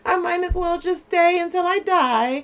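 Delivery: high, drawn-along resigned imitation; mock resignation. Imagined woman staying in a miserable relationship, resigned.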